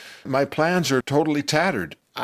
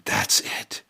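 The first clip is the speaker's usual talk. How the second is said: hushed whisper to himself; whispered realization; 5 dB quieter than usual. Retold or imitated. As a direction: breathe it as a secret thought. Inner thought, sudden realization.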